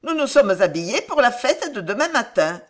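put-on a guileless girl proud of her outfit; naive girl. A narrator's reading. Ribaude explains naively to Montluc.

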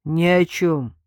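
loud, curt dismissal; blunt and final; cutting retort. A narrator's reading. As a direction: shut the question down. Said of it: "отрезал Артём".

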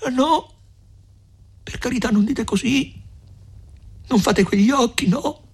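very high, imploring cry; tearful pleading. A narrator's reading. Lucia begs Renzo not to talk so, tearful pleading. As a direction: beg in a high voice, as if weeping. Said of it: "esclamò Lucia piangendo, supplicando".

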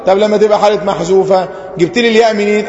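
usual speaking voice

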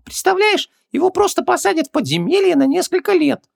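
very high, squeaky little genie, offended; Хубаксис. A narrator's reading. Genie butts into his master's conversation, offended. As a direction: pipe up indignantly in a tiny squeaky voice. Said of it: "обиженно вмешался в разговор Хубаксис".

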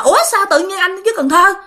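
retold indignant question, high and quick; annoyed disbelief. Girlfriend questions boyfriend being in Cần Thơ, annoyed. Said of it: "Thì tự nhiên nó tức lắm".

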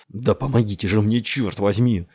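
loud, exasperated plea for help; through gritted teeth. A narrator's reading. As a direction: force it out through clenched teeth. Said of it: "проскрежетал он зубами". (сквозь зубы, зло и громко)

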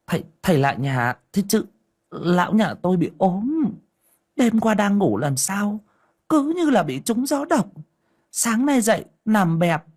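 high, eager chatter to a guest; a wife eagerly greeting the master at the door; fussing welcome. Acted. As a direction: gush over the visitor, talking fast. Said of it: "Bà đon đả".

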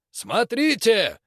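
slow, high, loud call; loud proclamation. Acted. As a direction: call slowly for all to look. Preacher shows stone to crowd.

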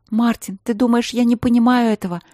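fervent plea. Acted. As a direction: pour it all out, desperate. Emotional outburst.

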